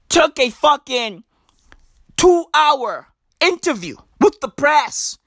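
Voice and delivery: normal talking voice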